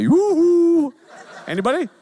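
high, loud sing-song Yoo-hoo!; high-pitched yoo-hoo. Retold or imitated. Relatives arriving at the house, calling high-pitched. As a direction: call Yoo-hoo! through an open door. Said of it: "even the men in a high-pitched voice say".

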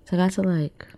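ordinary delivery

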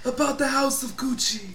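put-on Godfather-style Italian accent. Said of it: "Is that your Italian accent?".

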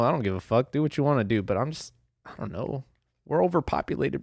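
ordinary talk, nothing marked

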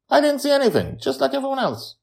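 put-on very high girl voice; a small girl answering innocently; Joy replies, high girl voice; little girl voice. A narrator's reading.

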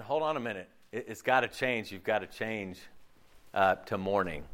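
low, grave warning; solemn God. Retold or imitated. Preacher voicing God calling people to mourn, low and grave. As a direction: sink the voice deep and serious. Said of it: "he's saying".

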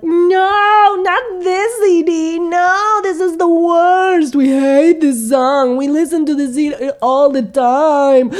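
high, loud wailing protest; a Sofia Vergara-like shrill whine; whiny teens. Retold or imitated. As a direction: wail like a spoiled teenager. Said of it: "sound like Sofia Vergara".

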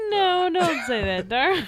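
high, slow whiny plea; mock pleading wife. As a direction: ham up a wounded wife, slowly.

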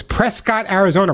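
Prescott with a full "cot" ending; mispronounced Prescott. Retold or imitated. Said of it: "It's not Prescott".

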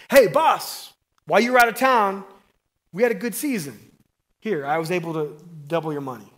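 mock-excited servant; excited report. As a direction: burst in proud with good news.

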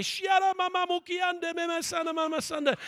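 imitating ecstatic tongues; bursting tongues. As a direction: pour out the tongues in a rush. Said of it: "burst out there".

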